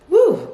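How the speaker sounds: high, loud gushing woo; delighted whoop. Real, not acted. Gushing about Regina King.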